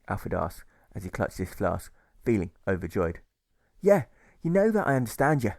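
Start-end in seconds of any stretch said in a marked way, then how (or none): 3.8-5.5 higher, louder reassuring reply; deserter answering. A narrator's reading. Deserter reassuring Alfred, higher and louder. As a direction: insist kindly but firmly. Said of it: "deserter answers Alfred".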